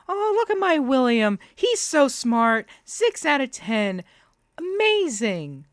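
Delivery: high, slow gushing parody of a proud mum; mock proud Violet. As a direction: coo over a bad grade in mock pride. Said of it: "show your little friends".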